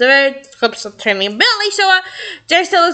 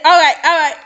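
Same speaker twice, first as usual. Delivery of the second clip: ordinary delivery, nothing marked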